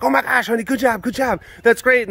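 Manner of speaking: put-on praising girlfriend's shotgun kill, excited and high; excited praise.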